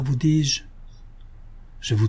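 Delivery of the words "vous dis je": usual speaking voice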